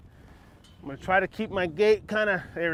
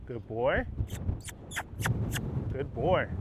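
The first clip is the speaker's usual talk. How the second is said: soft praise; 5 semitones lower, 7 dB quieter, slower than usual. Real, not acted. Soothing horse during training.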